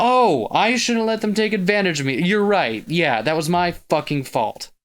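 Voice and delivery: high, loud mocking echo of the scolding; sarcastic mocking. Narrator mocking Yaya's scolding, loud and sarcastic. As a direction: throw her words back with bitter sarcasm.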